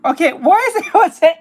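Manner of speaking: laughing. Real, not acted. Reacting while laughing.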